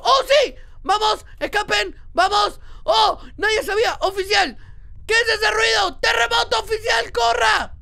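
high, loud urgent calls; excited shouting. Real, not acted. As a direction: yell short bursts to rally an escape. Escape starts, calls out to officer. (gritos de escape, exaltado)

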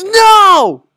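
high, loud scream of NO; frustrated scream. Real, not acted. Gamer failing the jump, frustrated scream. Fails in rage game.

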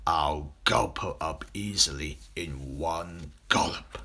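low, slow crocodile voice; greedy crocodile. A narrator's reading. Crocodile thinking of eating the girl, low greedy voice. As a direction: gloat privately over the prey.

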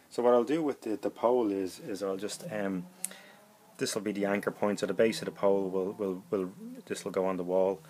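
usual speaking voice

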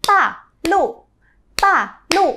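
第四声 高起急降; sharp fourth tones. Shown as a demonstration. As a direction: start each syllable high then cut it off hard. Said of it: "vỗ tay kiểu".

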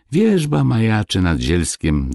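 ordinary delivery

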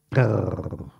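low, soft grumpy growl; sulky low grumble of a growl. A narrator's reading. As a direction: growl instead of answering. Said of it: "grogna monsieur Grincheux".

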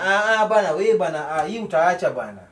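normal talking voice

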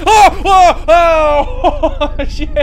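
loud high yelps mixed with laughing; startled yelps. Real, not acted. Caught by a hiding enemy, yelping loud. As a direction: yelp at the surprise. Jump scare.

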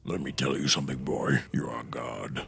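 low, solemn warrior voice; hammed-up stern god addressing his son; Kratos voice. Holds Kratos voice.

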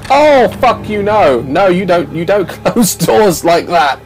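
loud, outraged shout at the door; angry yell. Real, not acted. Player yelling at an enemy who slammed door, angry shout. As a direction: yell at it, furious but laughing.

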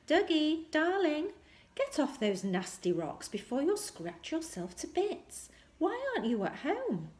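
high, loud fussing mother's call. Acted. Mother hurrying down the beach calls her son off the rocks, high and loud. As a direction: call him off the rocks like an anxious mum.

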